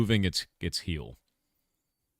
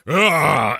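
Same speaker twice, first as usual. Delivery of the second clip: sharp mock yell, higher and louder; monster yell; 6 semitones higher, 9 dB louder than usual. Streamer imitating the monster charging at players, short mock yell. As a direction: bark it out like a monster charging at you. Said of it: "And it just goes".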